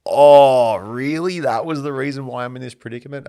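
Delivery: a sarcastic retort to his brother's excuse; mock sarcastic disbelief. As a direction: throw it back at him with outraged sarcasm. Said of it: "And I was like".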